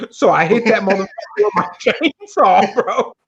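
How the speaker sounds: high voice breaking into laughter; laughing. Retold or imitated. Telling how the buddy hit the snake, laughing. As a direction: laugh through the punchline.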